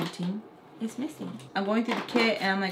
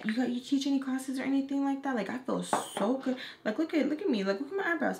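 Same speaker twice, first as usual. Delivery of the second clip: higher, giddy self-admiring gush; feeling herself; 5 semitones higher than usual. Retold or imitated. Girl admiring her fresh makeup, gushing higher. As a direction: imitate a girl delighted with her new look. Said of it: "feeling herself".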